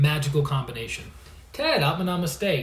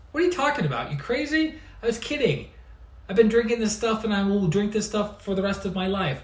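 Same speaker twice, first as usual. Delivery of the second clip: much higher, laughing off the idea as crazy; incredulous friend; 6 semitones higher, faster than usual. Retold or imitated. Friend telling the speaker he was kidding, high incredulous scoff. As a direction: scoff at the question like it's absurd. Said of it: "he goes".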